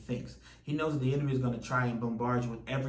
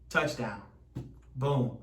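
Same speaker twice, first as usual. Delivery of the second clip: usual speaking voice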